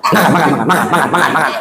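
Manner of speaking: rapid-fire repeated words, no gaps; hurried feeding. Acted. Mother feeding her child, hurried. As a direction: rattle it off as if cramming food in. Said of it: "Kalau ibu nyiapin anak".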